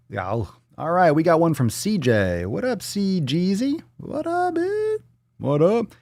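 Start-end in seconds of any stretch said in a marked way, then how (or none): 2.4-5.9 goofy squeal rising very high; stretched, squeaky hello; silly high greeting. Pretend. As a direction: greet them in a playful falsetto. Silly greeting to a question sender.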